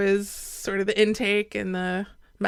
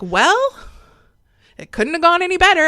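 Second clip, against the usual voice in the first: retold upbeat report; 8 semitones higher, 5 dB louder than usual. Mom reporting back on how it went, upbeat. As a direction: imitate mom's higher, quicker good news. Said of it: "And she's like".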